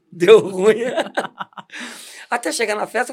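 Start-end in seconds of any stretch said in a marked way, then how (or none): 0.1-1.5 loud laugh through the words; cracking up as he says it. Real, not acted. Laughing at own story. (rindo alto)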